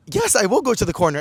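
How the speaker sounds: high-pitched, hammed-up yes; eager acceptance. Accepting the man's invite to the club corner, eager.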